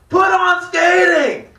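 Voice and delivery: put-on very high, slow drunken shout; drunk guy shouting. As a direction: let it rip like a drunk nobody hears. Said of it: "And he's like".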